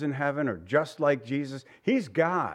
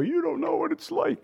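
much higher put-on whiny objector voice; mock complaint; 13 semitones higher than usual. Pastor mimics someone telling Jesus he cannot understand, high and mocking. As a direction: play a petulant doubter, pitched way up. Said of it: "so we could never say".

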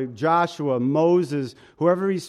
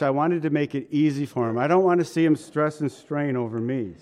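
ordinary talk, nothing marked

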